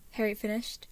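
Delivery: ordinary delivery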